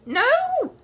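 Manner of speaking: very high, tiny voice from inside a box; small muffled voice. Acted. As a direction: sound shut in and far away. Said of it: "said a small and muffled voice".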